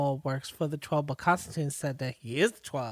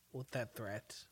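normal talking voice

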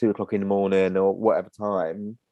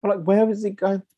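incredulous; 12 semitones higher than usual. Real, not acted. Incredulous joke.

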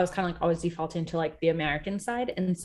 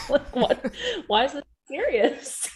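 laughing; 8 semitones higher, 4 dB louder than usual. Real, not acted. Laughing at the memory.

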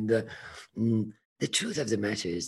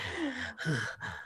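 shaky sigh; 13 semitones higher, 7 dB quieter than usual. Real, not acted.

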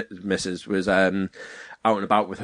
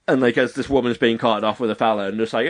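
ordinary delivery

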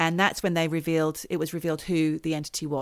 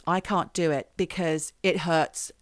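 ordinary talk, nothing marked

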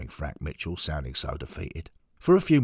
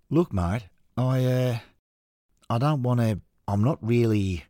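put-on Australian accent; 4 semitones higher, 4 dB louder than usual. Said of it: "Morris notices the Australian accent".

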